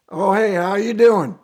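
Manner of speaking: loud imitated Southern greeting; strong Southern accent. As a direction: play the Southern dad picking up, accent put on. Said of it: "they got strong Southern accent".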